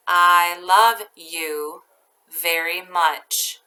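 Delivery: each chunk very slow with long pauses; teacher dictating a sentence to first graders, slowly; slow dictation. Shown as a demonstration. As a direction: dictate it piece by piece. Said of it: "I'll read each part very slowly".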